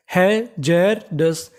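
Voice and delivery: Hazardous very slowly as hai-jar-das; syllable by syllable. Shown as a demonstration. Teacher building the whole word for learners, syllable by syllable. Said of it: "तो पूरी meaning".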